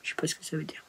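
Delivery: a puzzled whispered aside. Real, not acted. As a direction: mutter it under your breath, puzzled. Puzzled aside after spelling word.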